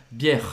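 "Bière" with the R voiced at its end; final R sounded. Shown as a demonstration. Teacher reminding learners of the final R, final R sounded. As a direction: finish "Bière" on a clear R. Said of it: "tem o R no final da palavra".

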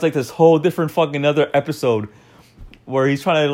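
usual speaking voice

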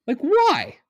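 high, outraged why; exasperated why. Real, not acted. Host venting at the player, an exasperated high why. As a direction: throw up your hands. Ranting about a dirty hit.

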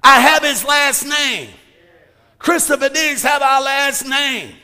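loud, rising preacher's proclamation; full force preaching. Acted. Pastor preaching inheritance to congregation, full force preaching. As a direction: preach it to the rafters.

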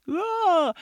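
a high mock wail, like a crying little girl; imitated child's wail. Mother mimics her daughter bursting into tears, high wail. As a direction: shriek it out like the upset kid. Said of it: "Junie starts bawling and crying and just like".

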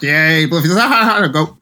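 light mock Rodgers running the hurry-up; teasing quarterback calling the snap; Aaron Rodgers impression. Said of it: "that was your Rodgers impersonation?".

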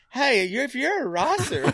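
high mock-accent voice, racer as "Rosser"; hammed-up high-pitched caricature; Dwight impression. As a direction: imitate his odd accent up high. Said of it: "but he's like".

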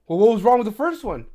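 high, loud sarcastic question; retold loud challenge to the customer; irritated challenge. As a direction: push back hard. Acted exchange with customer.

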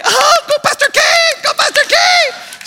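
high, loud mock cheer; exaggerated cheering from the stands; amused crowd cheering. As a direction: holler like a delighted onlooker egging her on. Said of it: "Everyone thinks it's funny".

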